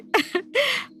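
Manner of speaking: high, gleeful gloating cackle; scheming mother-in-law cackling with glee. Acted. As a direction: cackle in triumph over the daughter-in-law. Gloats that ghee ran out.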